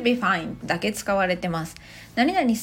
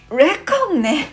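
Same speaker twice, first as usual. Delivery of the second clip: louder, higher burst of delight; a language nerd finally getting a word; thrilled realisation; 6 semitones higher, 7 dB louder, much slower than usual. Retold or imitated. Learner finally understanding reckon, loud and excited. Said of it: "ものすごくテンションが上がります".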